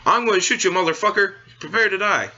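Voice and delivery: mock cartoon villain. Mocking how cartoons handle a kill, hammed-up high threat. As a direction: ham up a silly cartoon killer. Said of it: "This is how people are gonna, kill someone".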